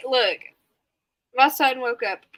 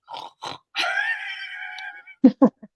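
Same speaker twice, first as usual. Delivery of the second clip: shrieking laugh. Real, not acted. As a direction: let a squealing laugh explode. Cracking up at the chat.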